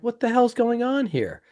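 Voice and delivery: high-pitched mock bewilderment; retold indignation. Retold reaction to a stripped cabin.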